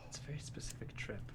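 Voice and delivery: breathy hushed aside; murmure-le tout bas, sans voix; whispering. Real, not acted. Whispered aside, then repeated.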